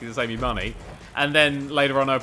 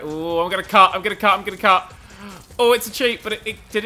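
excited; 5 semitones higher, 6 dB louder than usual. Real, not acted. Cutting a corner in race.

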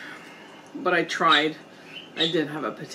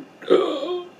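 sharp gasp; 13 semitones higher, 4 dB louder than usual. Real, not acted. Shocked at the stain.

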